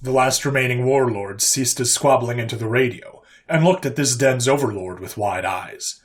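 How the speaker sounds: plain reading voice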